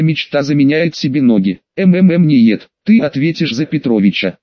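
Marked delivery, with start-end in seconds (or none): none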